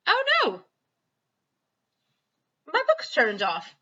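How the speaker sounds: real surprise as the book cuts out; real dismay. Real, not acted. Reader's device switches off.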